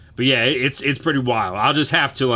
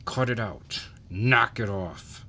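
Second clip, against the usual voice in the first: through gritted teeth; 5 dB quieter, slower than usual. Shown as a demonstration. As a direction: scold through a clenched jaw. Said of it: "talk through your teeth".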